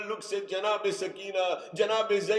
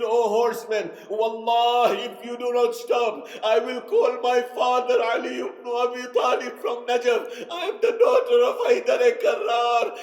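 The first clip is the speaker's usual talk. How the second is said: loud, raised defiant threat; fierce warning; 3 semitones higher, 6 dB louder than usual. Acted. Zainab warning the veiled horseman, loud and defiant. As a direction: stand your ground and call out the threat.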